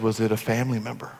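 low, hushed, quick question; careful, low-voiced inquiry; gentle pastor. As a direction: ask it softly, as to a mourner. Pastor gently questions grieving man.

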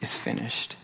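soft, breathy final words; quiet dying cry; hushed cry. Acted. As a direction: let it out like a last breath. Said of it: "And his cry is".

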